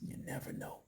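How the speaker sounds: whispered, almost voiceless aside; knowing whisper. Real, not acted. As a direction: drop to a whisper, as if hinting. Hushed repeat to the viewers.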